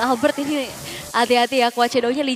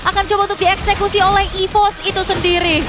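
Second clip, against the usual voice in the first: higher and louder hype caster call; excited play-by-play; 6 semitones higher, 4 dB louder than usual. Real, not acted. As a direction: build the momentum like a caster. Co-caster hyping the swing. (komentator esports yang berapi-api)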